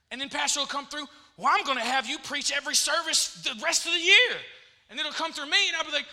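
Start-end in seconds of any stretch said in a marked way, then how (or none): 1.4-4.4 raised, loud and emphatic; excited announcement. Acted. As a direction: announce big news with gusto. Voices pastor's big announcement.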